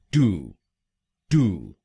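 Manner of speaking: टू said "too" with no y glide; American first syllable. Shown as a demonstration. Teacher showing the American first syllable, no glide. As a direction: drop the y: टू as "too". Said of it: "American English में ... टू".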